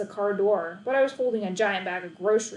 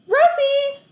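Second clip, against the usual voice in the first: very high, loud shout; boy calling his lost dog; cried out; 11 dB louder than usual. A narrator's reading. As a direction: yell the dog's name. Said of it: "I cried".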